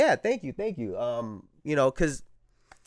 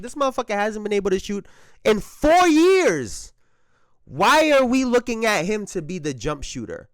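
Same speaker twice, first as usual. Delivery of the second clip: high retold rant, leaning on the key words; exasperated disbelief; 8 semitones higher, 4 dB louder than usual. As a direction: protest high, stretching it out in disbelief. Own exasperated reaction, retold.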